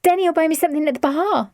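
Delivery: high, quick and breezy; airy. A narrator's reading. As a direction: toss it off lightly.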